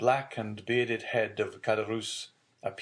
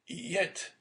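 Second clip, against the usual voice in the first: put-on higher-pitched, hesitant young man; mild stammered hesitation; 9 semitones higher than usual. A narrator's reading. Son questioning his father about money, mildly hesitant. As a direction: falter lightly on the word. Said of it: "stammered Dante".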